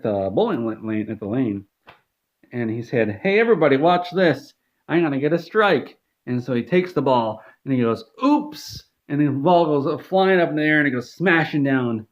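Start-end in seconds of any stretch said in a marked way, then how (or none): 3.2-6.0 high, louder show-off call; high mock boast before the throw; boastful Todd Howard. 8.2-8.8 high fake-innocent oops; high hammed-up oops; mock oops. Fake accident throwing ball.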